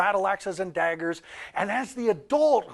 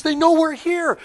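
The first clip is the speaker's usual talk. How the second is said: much higher and louder, urgent; alarmed orc; 11 semitones higher, 10 dB louder than usual. Retold or imitated. Orc turning to warn the others, loud and urgent. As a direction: sound the alarm, panicked. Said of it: "the guy turns around and says".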